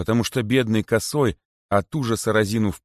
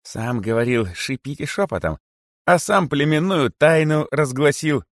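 high, needling reproach; snide; 7 semitones higher, 4 dB louder than usual. A narrator's reading. Korotyshka taunts the great python for hypocrisy, snide. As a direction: needle him in a raised, mocking pitch. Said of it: "ехидный смех коротышки".

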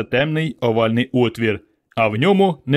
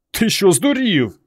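astonished; 8 semitones higher, slower than usual. A narrator's reading. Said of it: "витріщив очі Іван".

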